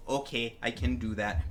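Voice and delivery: low, soft-spoken composer's reply; composer impression. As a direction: drop low and quiet, imitating the composer. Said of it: "because that's how he talks".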